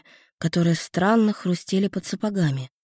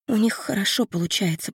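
usual speaking voice

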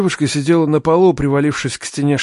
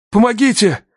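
high, urgent shout; crying out; 8 semitones higher, 4 dB louder than usual. A narrator's reading. Man carrying the girl to ambulances, desperate cry. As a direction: call desperately for help. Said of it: "крикнул я".

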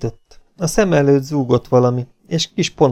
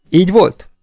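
sharp demand; 9 semitones higher, 8 dB louder than usual. Acted. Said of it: "A lányhoz fordult".

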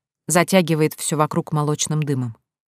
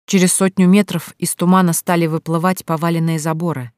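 ordinary delivery

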